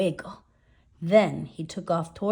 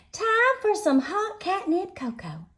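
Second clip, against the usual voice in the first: high-pitched motherly call; 12 semitones higher than usual. A narrator's reading. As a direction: call out a treat to the children. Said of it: "called out Mama Junebug Jones".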